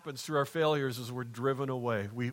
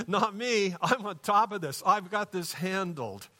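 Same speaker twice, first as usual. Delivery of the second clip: lightly acted overconfident reply, pitched up; higher-pitched mock self-assurance; mildly cocky boast; 6 semitones higher than usual. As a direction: brush off the warning with breezy overconfidence.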